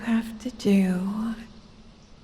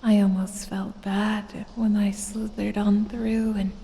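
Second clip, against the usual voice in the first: ordinary talk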